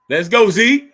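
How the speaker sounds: cheering. Real, not acted. Fan cheering Zeke on at the screen, loud shout. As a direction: shout him on.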